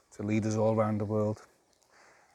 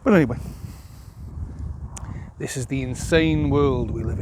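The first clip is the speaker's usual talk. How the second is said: raised voice; 4 semitones higher, 7 dB louder than usual. Real, not acted. Ranting about an insane world.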